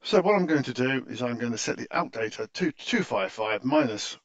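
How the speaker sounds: usual speaking voice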